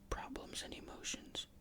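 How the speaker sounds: plain reading voice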